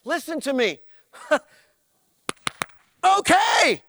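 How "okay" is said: okay said loud. Acted. Mock dialogue reply.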